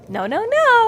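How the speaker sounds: very high, light no-no-no; high cartoon sheepdog voice; mock calm sheepdog. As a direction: chirp it like a cartoon. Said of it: "Sam would be like".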